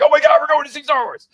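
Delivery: put-on high and loud, bursting with kid excitement; a giddy shriek of joy at going to see Star Wars; excited squeal. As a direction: squeal it like a thrilled child hearing the news. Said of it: "I'm like".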